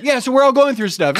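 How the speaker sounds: high-pitched hasty wrap-up; bailing out. Retold or imitated. Youth pastor who outed all the kids, hastily bailing. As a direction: cut it short, voice jumping up. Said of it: "had to bail on it".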